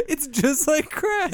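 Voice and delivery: very high, slow mock gushing; naive enthusiasm. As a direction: squeal with clueless optimism. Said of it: "where someone's like".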